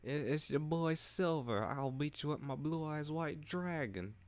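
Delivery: brash Brooklyn swagger like Joey Wheeler; over-the-top Brooklyn accent, bragging. Acted. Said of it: "Joey Wheeler accent, Brooklyn accent".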